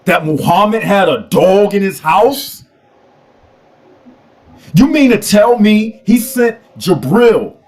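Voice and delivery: ordinary delivery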